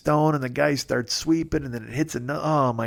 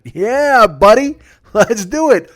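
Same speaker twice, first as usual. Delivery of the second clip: loud, higher hyped stoner cheer; stoner buddy voice; 9 semitones higher, 10 dB louder, much slower than usual. Retold or imitated. Stoned friends hyping a driveway curling sesh, loud and high. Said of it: "That's my bong imitation".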